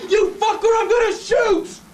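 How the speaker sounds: very high, loud threat; panicked, full-force shout; frantic yell. Acted. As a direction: scream the warning, gun drawn. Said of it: "I grabbed my gun and I yelled".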